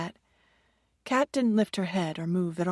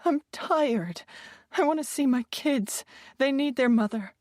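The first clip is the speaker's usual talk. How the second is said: higher, tearful plea; starting to cry; 7 semitones higher than usual. A narrator's reading. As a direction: voice breaking toward tears. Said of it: "starting to cry".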